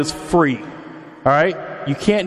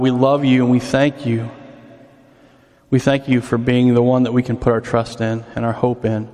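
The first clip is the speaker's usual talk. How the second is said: prayer; 4 semitones lower than usual. Acted. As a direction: pray it gently to God. Opening prayer to God.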